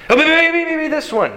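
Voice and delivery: high, jittery repeated guessing; mock pre-panic. Worrier seizing on the next threat, mock pre-panic. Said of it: "of pre-panic".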